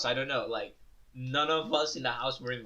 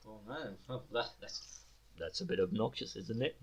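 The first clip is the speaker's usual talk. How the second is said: soft, lower grumble under the breath; muttered aside; 4 semitones lower, 7 dB quieter than usual. Retold or imitated. Narrator recalls muttering alone while cooking rice, soft and low. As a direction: quietly complain to yourself in the kitchen. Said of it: "And I'm just like".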